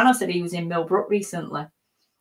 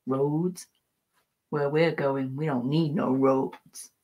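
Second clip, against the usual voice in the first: lightly voiced, slow Doc Brown line; a hint of the mad scientist, drawn out; Doc Brown quote; 2 semitones lower, 3 dB quieter, slower than usual. Friend quoting his favourite Doc line, a light impression. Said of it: "your favourite one to say is".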